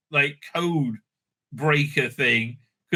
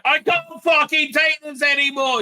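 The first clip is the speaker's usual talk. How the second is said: high yelled outburst; mock shout; 11 semitones higher, 4 dB louder than usual. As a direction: shriek it like she's losing it. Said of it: "so she's like".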